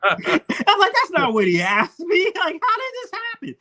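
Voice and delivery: high and slow, laughing through it; laughing disbelief. Retold or imitated. Telling the friend how editing fooled viewers, laughing. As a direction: protest while cracking up.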